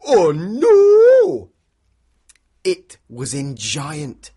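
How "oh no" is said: high loud cry of alarm; big dramatic oh no; cried out. Shown as a demonstration. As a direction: cry it out for the kids to copy. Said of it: "Can you do that with me".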